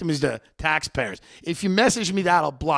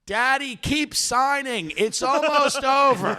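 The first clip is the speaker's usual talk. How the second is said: high, drawn-out mock coaxing; son urging father; 7 semitones higher, slower than usual. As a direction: imitate a son slowly calling to a confused parent. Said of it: "his son would look at him and go".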